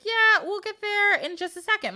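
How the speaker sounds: husband brushing off his wife's question, high and breezy. Acted. Husband on horse reassuring bride, high and breezy. Husband answers on long ride.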